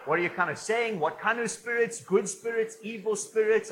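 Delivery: loud, high-pitched mock confusion; baffled outsider. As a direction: fire off puzzled questions as the clueless listener. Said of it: "Guys are like".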